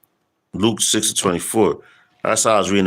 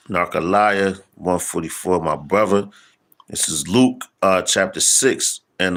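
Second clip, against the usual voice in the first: usual speaking voice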